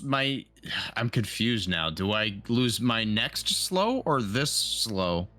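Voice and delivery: ordinary talk, nothing marked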